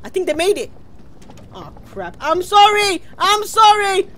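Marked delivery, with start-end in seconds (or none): 2.2-4.1 high, loud cry of sorry; shouted apology. Real, not acted. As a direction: wail sorry to teammates. Apologising loudly to teammates.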